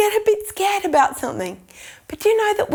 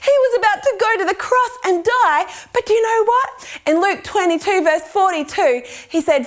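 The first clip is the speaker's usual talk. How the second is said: ordinary talk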